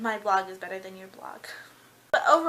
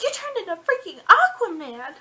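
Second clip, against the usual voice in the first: higher, louder put-on exclamation; hammed-up girl voice; mock amazement; 9 semitones higher, 10 dB louder than usual. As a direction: blurt it in playful disbelief. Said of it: "And she's like".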